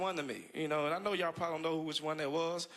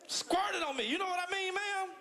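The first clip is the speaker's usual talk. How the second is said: high, laughing disbelief; incredulous; 11 semitones higher than usual. Real, not acted. Comic repeats squirted story to a woman, incredulous. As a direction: ask her in amused disbelief.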